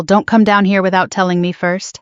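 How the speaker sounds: ordinary delivery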